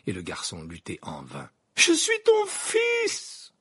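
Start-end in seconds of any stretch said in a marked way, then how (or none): 1.8-3.5 very high, slow drawn-out cry; anguished son's loud wail; desperate screaming. Acted. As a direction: scream it at your father. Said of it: "criait celui-ci à Croupton".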